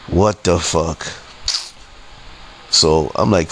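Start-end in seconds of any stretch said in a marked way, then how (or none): 0.1-1.1 low-key, resigned muttered disbelief; deflated exasperation. Retold or imitated. Customer hearing police are coming, deflated exasperation. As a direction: say it evenly, as a weary retold thought. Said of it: "I'm steamed now".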